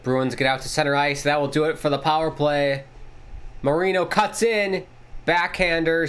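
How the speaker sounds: steady broadcast call of the action; play-by-play. Acted. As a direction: call the game like a TV announcer.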